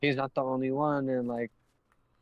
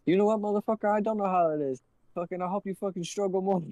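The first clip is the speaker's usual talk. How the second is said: higher, heated comeback; raised-pitch telling-off, moderately heated; retold angry retort; 6 semitones higher, 3 dB louder than usual. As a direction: snap back, fed up.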